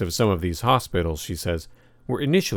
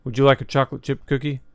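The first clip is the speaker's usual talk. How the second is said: put-on higher, quick polite little-boy offer; lightly voiced young boy, politely offering; polite child's question; 6 semitones higher, faster than usual. A narrator's reading. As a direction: ask it quickly and politely, pitched up. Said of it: "asks politely".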